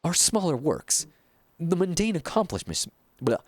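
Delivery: theatrical, self-important painter with sweeping pitch swings; pompous art master. Acted. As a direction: declaim like a grandiose mentor to a disciple. Reads a pompous painter's game dialogue.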